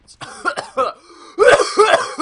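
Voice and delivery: loud hacking cough; violent coughing fit. Real, not acted. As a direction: really hack up a lung. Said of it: "Oh my god, I'm dying".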